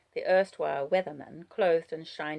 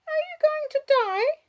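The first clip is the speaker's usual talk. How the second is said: put-on high child voice, less loud; child's question; 9 dB louder than usual. A narrator's reading. Child asking hurt Wallace, high questioning voice. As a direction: ask it as a small girl, rising at the end.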